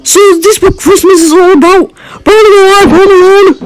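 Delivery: high-pitched, wailing question; distraught protest. Acted. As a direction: plead in distress at the Grinch. Boy protests to the Grinch.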